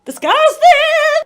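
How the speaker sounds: high, loud, drawn-out disgust; hammed-up disgust. Reader reacts to the book, theatrical disgust. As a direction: stretch it out, revolted and loud.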